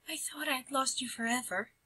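soft, breathy-free hush to her husband; whispered. Acted. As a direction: whisper it with relief. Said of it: "the town mouse whispered".

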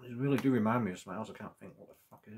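chewing while talking; talking between bites of snack; mouth full. Real, not acted. Seen 1: bit snack at -2.0, mouth working, snack in hand.

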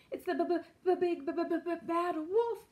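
high, stuttering piglet terror; teeth-chattering fright; chattering with fear. A narrator's reading. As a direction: stammer on the b sounds, high and drawn out. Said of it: "Boris chattered".

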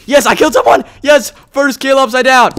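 loud, high cry of victory; real gamer celebration, high and loud; triumphant cheer. Real, not acted. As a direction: crow over a first win. Celebrates first kill.

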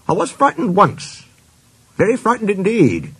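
high-pitched, mildly fearful recollection; confessing fright. A narrator's reading. Engine admits he was once scared, mildly fearful.

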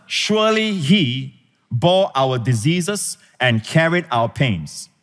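slow, deliberate reading of the verse; solemn slow quotation; slow scripture reading. Shown as a demonstration. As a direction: read it slowly, word by word. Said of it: "the emphasis is not on the work, but on the person".

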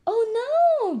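high, loud oh no; dismayed cry. Acted. George crying out in dismay. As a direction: cry out as the balloon slips. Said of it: "George cries".

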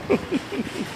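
high, short laughing burst; high burst of laughter. Real, not acted. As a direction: crack up at your own joke. Laughs after his joke.

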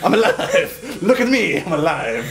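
triumphant laughing cheer. Real, not acted. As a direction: laugh it out, delighted.

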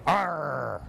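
pirate growl. Acted. Pirate captain growls hello.

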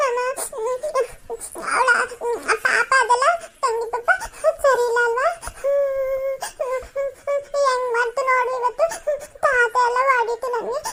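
put-on high-pitched child wailing between phrases; tearful child. Child complains to mother about grandfather's beating, sobbing. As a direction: sob out the complaint in a small high voice. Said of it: "ಅಳ್ಬಾರ್ದು".

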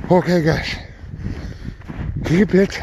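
breathless, panting between words; out of breath. Real, not acted. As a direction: gasp it out while catching breath. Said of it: "Almost out of breath, I can't even say it".

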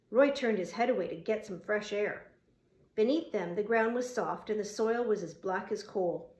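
usual speaking voice

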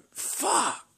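high, sharp curse; real annoyed curse; frustrated curse. Real, not acted. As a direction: spit it out in frustration. Another bad summon.

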